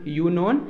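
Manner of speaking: यू नोन with "yoo" up front; wrong pronunciation. Shown as a demonstration. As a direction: say यू नोन as the mistaken "you-known". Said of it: "यू नोन नहीं होता है". (यू नोन, "यू" वाला गलत उच्चारण)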